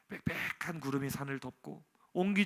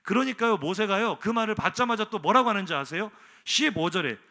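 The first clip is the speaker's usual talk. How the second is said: ordinary talk, nothing marked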